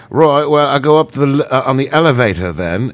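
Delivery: unconvincing put-on British accent. Shown as a demonstration. Showing how Americanese spoils it, fake British accent. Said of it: "everybody knows you're not really British".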